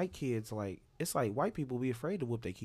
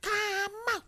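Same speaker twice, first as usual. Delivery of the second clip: high, loud yell across a store; hollering for her kid across the aisles; shrill-free high calling out; mock mom yelling; 4 dB louder, slower than usual.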